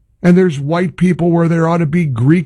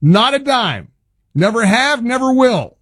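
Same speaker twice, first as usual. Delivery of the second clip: normal talking voice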